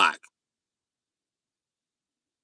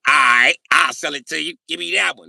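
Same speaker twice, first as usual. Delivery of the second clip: higher, louder acted-out acceptance; eager deal-making; 8 semitones higher, 10 dB louder than usual. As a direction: jump at the deal, loudly. Retold eager deal over the car.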